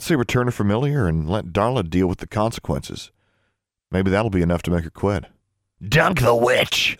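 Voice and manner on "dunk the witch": high, slow, snappish creature voice; snapping creature. A narrator's reading. Creature heckles the people deciding its fate, snappish. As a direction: snap it as a spiteful little beast. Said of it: "snapped Honey".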